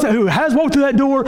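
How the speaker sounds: ordinary talk, nothing marked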